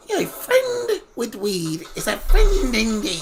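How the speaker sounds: high, slow, drawn-out leprechaun voice. Acted. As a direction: ham it up as a sly leprechaun. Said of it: "I like doing Leprechaun's voice".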